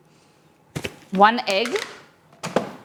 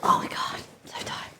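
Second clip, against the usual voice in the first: whispering; 7 dB quieter, much faster than usual. Real, not acted. Whispered complaint while cooking.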